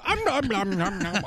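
playful nom-nom babble; imitating munching. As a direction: mimic a baby gobbling food. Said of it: "you do tend to go".

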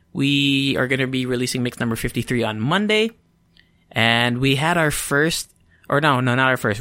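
normal talking voice

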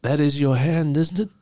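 a pompous crime boss gloating to his crew; Tooth Fairy mob boss. Acted.

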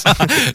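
high, breathy giggle burst; burst of laughter. Real, not acted. Amused by Google Viande joke. (éclat de rire aigu)

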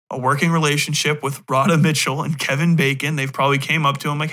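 usual speaking voice

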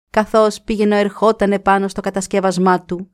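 usual speaking voice

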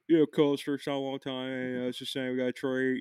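slurred mock Delco caller; mumbled Delco accent. Shown as a demonstration. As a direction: talk out of your mouth in Delco, barely enunciating. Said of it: "they don't enunciate everything".

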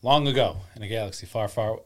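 booming Star Wars opening crawl; epic movie intro. Acted. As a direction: announce it like a film trailer. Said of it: "that was Star Wars".

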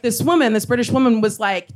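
ordinary delivery

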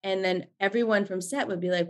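ordinary talk, nothing marked

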